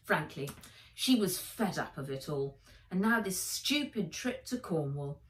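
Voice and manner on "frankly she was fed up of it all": usual speaking voice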